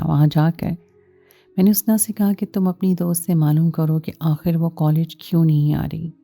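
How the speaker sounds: ordinary delivery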